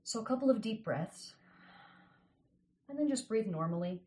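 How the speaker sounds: calm them into the visualization; guided meditation. Acted. Guides breathing, eyes closed.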